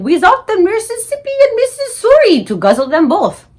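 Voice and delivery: high, loud bellowing giant cry; mock giant yell. BFG mimics the giants yelling, loud and high. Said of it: "they was yelling".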